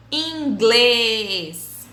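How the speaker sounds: inglês with a long, loud GLÊS; stressed syllable stretched. Shown as a demonstration. Teacher modelling circumflex words, inglês with GLÊS drawn out. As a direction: hold the closed ê in inglês. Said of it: "a sílaba mais forte".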